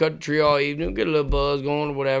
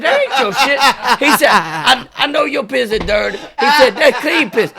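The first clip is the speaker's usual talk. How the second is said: laughing mock insistence; 9 semitones higher, 7 dB louder than usual. Friend insists it's clean.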